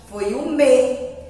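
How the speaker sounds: ordinary talk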